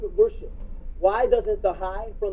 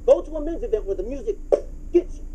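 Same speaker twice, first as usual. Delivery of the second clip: ordinary talk, nothing marked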